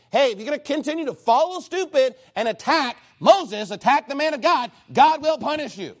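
fiery pulpit warning; forceful preaching. Acted. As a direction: hammer the warning home to the congregation.

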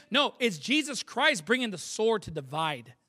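ordinary talk, nothing marked

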